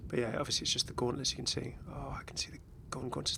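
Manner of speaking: soft, hushed aside; quiet whispered noticing. Real, not acted. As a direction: murmur it to yourself. Whispering while browsing skins.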